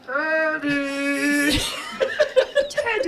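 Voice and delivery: high Mr Bean impersonation. Pretend. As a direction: squeak Teddy like Mr Bean. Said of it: "Mister Bean".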